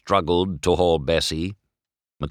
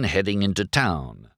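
plain reading voice

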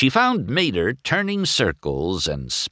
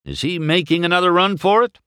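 high, fast and loud; urgent question; 11 semitones higher, 4 dB louder, faster than usual. A narrator's reading. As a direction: demand an answer quickly. Doc asks Sheriff urgently.

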